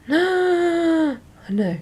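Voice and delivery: gasping high mock shock. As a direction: gasp as if scandalised. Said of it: "shocking stuff".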